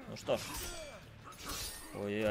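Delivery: normal talking voice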